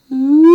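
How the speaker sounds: a loud high ooh cracking at the break. Shown as a demonstration. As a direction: push up until the voice breaks. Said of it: "you hear my voice go".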